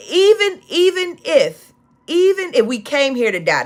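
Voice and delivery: "even" shouted high and long; fervent preaching cry. Real, not acted. Preacher overcome recalling the elders' song, ecstatic cry. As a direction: cry out "Even, even if" in full fervour.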